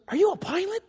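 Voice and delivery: put-on high, rising kid question; curious little boy. Boy asking his seatmate about flying, high curious voice. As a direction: imitate an amazed five-year-old. Imitating awed boy's question.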